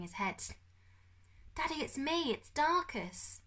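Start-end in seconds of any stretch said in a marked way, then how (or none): 1.5-3.4 put-on higher, slow boy's voice; boy's plea. A narrator's reading. Son finding his captive father, higher slow young voice. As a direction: reach out to him gently as his son.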